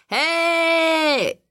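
a loud, high bellow; roaring shout. A narrator's reading. Tom roaring at the glass coffin, loud and high. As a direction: yell it to wake the dead. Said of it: "zařval".

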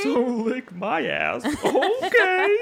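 very high, slow teasing voice; high sassy taunt. Acted. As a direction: sass them in a squeaky taunt.

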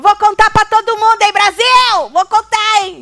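high, loud, showy teasing boast; mocking Lucas boast. Mimicking Lucas's loud promise to spill a secret to Brazil. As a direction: ham up Lucas's big threat to tell Brazil. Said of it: "Lembra quando o Lucas falou".

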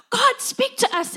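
high, pleading cry upward; pleading plea. Retold or imitated. Pleading to God for an answer.

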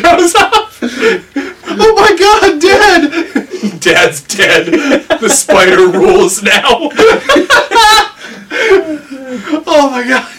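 high, breathless laughter through the words; can barely get it out for laughing; laughing hard. Real, not acted. Picturing the friend pranking his own kid, laughing hard.

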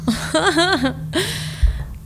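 high, breaking laugh; real emotional laugh caught between tears; teary laugh. Real, not acted. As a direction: laugh through welling tears. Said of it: "I'm gonna start crying now".

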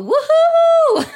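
high, loud celebratory woohoo; excited whoop. Real, not acted. Podcaster cheering her wild conference week, excited whoop. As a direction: let out a long, high cheer.

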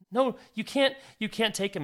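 put-on begging him not to take her baby; pleading mother.

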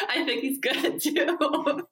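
laughing high-pitched agreement; amused, laughing, slow and high. Real, not acted.

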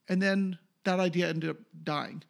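usual speaking voice

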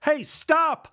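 high sharp yell after a thief; yelling stop. Acted. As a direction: shout at the fleeing kid. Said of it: "I yelled".

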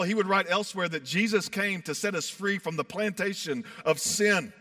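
ordinary delivery

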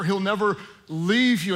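normal talking voice

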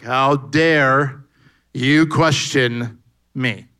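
loud, slow, booming wrath; a parody of an angry God thundering; mock offended God. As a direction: play God as furious at being questioned.

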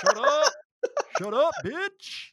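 shouted high, hyped-up hype man yell. Retold or imitated. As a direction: bark it out like a loud sidekick.

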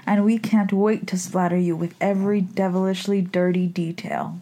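ordinary talk, nothing marked

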